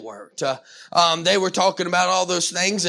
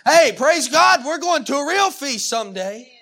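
higher, louder opening shout of praise; exuberant quote; 7 semitones higher, 5 dB louder than usual. Retold or imitated. Preacher mimicking a confident dinner guest, exuberant. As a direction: voice him as eagerly expectant. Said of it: "trying to say something like that".

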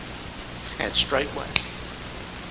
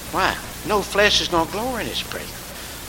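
ordinary talk

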